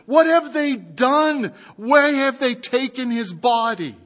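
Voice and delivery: high, loud, anguished calling out; Lucy crying out to her sister, sobbing. A narrator's reading. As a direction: cry out in grief, voice lifted high. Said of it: "Lucy just cries out, sobbing".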